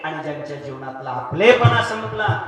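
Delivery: loud, rising kirtan climax; preaching at full force. Acted. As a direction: build to a ringing peak. (जोरदार कीर्तनी आवाज)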